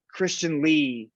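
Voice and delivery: ordinary talk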